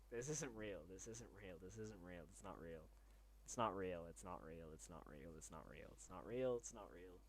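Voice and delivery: soft, dazed repetition under the breath; stunned murmur. Real, not acted. Streamer to himself as gifts flood in, hushed disbelief. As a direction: say it to yourself, unable to believe it.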